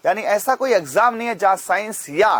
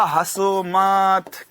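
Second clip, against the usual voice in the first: drawn-out warning; much slower than usual. Retold or imitated. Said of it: "दादाजी ने क्या बोला?".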